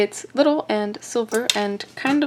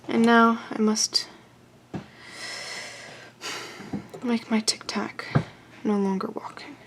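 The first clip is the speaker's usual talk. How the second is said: mock solemn whisper; 6 dB quieter than usual. Maker cutting the Tic Tac's legs, mock solemn whisper. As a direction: whisper it as if mourning.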